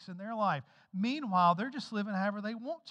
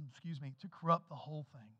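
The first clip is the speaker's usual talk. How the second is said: ordinary talk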